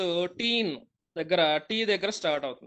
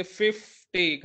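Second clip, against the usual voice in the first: Fifty drawn out slowly, fif pressed hard; slow stress on FIF; much slower than usual. Shown as a demonstration. Teacher repeating fifty slowly, stressing fif. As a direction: stretch fifty and lean on fif. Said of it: "గట్టిగా ఒత్తి పలుకుతున్న ఫిఫ్ అని".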